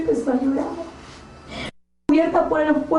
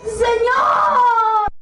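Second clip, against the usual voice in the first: crying shout; 5 semitones higher than usual. Retold or imitated. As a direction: beg forgiveness in tearful shouts. Damned woman begging forgiveness. (grito llorando pidiendo perdón)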